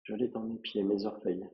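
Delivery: soft, unhurried meditation voice with long pauses; guided relaxation. Acted. Yoga teacher guiding a student through a relaxing body scan, softly and calmly. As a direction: lead a quiet body scan, phrase by phrase.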